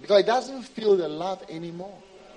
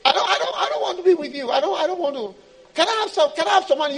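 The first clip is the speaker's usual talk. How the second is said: high, loud begging; pleading believer; 9 semitones higher, 6 dB louder, much faster than usual. Acted. Believer begging God for the car, pleading.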